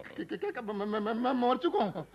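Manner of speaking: flustered comic fright; shocked stammer. Acted. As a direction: trip over the words in disbelief. Learns that he is dead.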